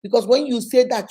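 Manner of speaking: ordinary talk, nothing marked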